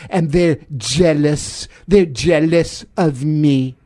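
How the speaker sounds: boastful presidential impression; Donald Trump. President boasting about why they're after him, blustering impression. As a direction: brag as the president, drawing out the last phrases. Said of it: "enough of Don".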